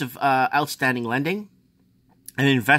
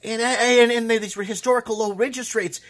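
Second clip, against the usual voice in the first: worked-up, high squealing tirade; high-pitched rant; 11 semitones higher than usual. Real, not acted. Ranting to viewers about fake paper wealth, worked-up high voice.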